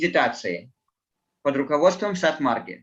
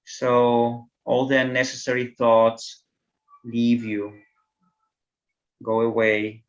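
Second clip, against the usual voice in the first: guided meditation; 4 semitones lower than usual. Acted.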